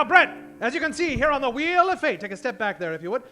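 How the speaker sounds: ordinary delivery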